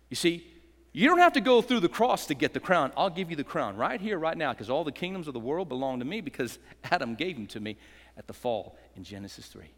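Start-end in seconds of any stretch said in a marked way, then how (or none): none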